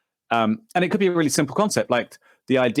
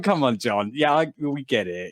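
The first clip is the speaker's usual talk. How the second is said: ordinary talk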